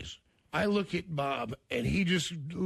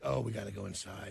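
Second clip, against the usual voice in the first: low, soft hurried warning; hushed urgent; 10 semitones lower, 5 dB quieter than usual. Retold or imitated. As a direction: warn them quietly. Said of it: "everybody's like".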